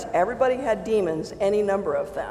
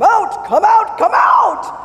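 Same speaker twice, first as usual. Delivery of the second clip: high, loud repeated command, getting louder; mock shouting; 12 semitones higher, 11 dB louder, slower than usual. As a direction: imitate a preacher yelling demons out. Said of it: "the shouted out deliverance people".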